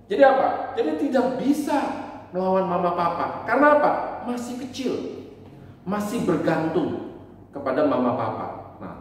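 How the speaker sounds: ordinary talk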